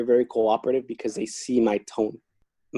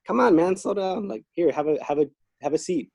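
much higher, easygoing coaxing; friendly coaxing; 6 semitones higher than usual. Retold or imitated. Guard coaxing an irritated inmate to sit, much higher.

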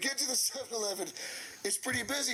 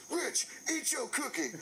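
high, insistent mock order; raised-pitch character voice, demanding; acted demand; 8 semitones higher than usual. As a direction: imitate an exasperated man giving an order. Retold boss's demand in a story.

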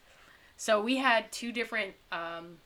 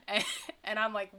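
ordinary talk, nothing marked

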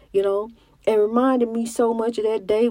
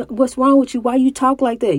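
higher, louder retold challenge; imitated cousin, pitched up and insistent; questioning cousin; 5 semitones higher, 5 dB louder than usual. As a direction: mimic a cousin challenging how someone talks. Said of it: "She said".

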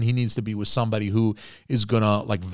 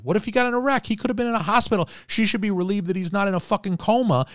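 much higher, incredulous pitch; indignant disbelief; 10 semitones higher, faster than usual. Real, not acted. Co-host arguing she should be concerned for him, indignant. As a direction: argue it in a high, exasperated voice. Indignant argument on his behalf.

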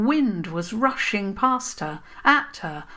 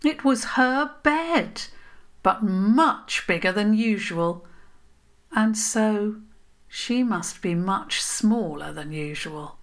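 plain reading voice

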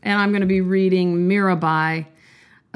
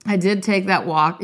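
normal talking voice

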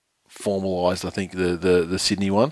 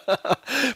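short high-pitched giggle. Real, not acted. Laughing at the joke.

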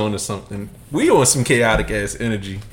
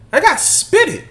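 put-on child's voice; 4 dB louder, slower than usual. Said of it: "son repeating".

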